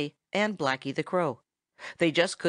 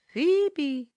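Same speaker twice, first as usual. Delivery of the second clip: high, whistled bird note; soft whistled phoebe; 10 semitones higher than usual. A narrator's reading. Farmer Brown's boy whistles Tommy's love note, softly. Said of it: "softest, sweetest whistle".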